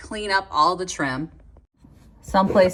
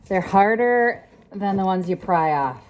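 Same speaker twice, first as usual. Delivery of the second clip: ordinary talk, nothing marked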